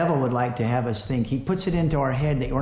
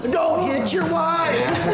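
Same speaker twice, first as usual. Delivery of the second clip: laughing warning; 13 semitones higher, much slower than usual. Real, not acted. Broom balancing nearly hits wife.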